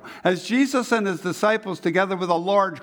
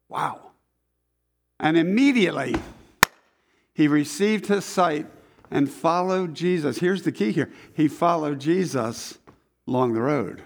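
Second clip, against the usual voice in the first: normal talking voice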